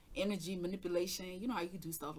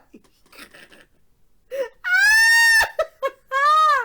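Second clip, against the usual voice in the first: real, very high, very loud shrieking laugh; screaming laugh; 22 dB louder than usual. Real, not acted. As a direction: explode into helpless laughter. Reacting to mama's line.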